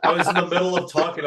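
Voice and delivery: loud, fast, excited retelling. Real, not acted. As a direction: tell it fast and loud, fired up. Laughing retelling.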